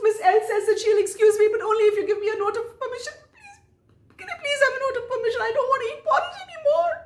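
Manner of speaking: high, fast begging on the edge of crying; a desperate child begging to be let off; tearful pleading. Retold or imitated. Child begging principal, near tears.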